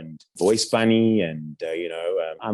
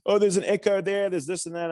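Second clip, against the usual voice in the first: high, nitpicking client voice; mock fussy client; 11 semitones higher than usual. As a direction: squeak out the complaint. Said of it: "kinda going".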